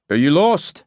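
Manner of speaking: high, lightly voiced local barman; Scottish barman. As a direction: ask like a curious bartender. Barman greets lost young woman.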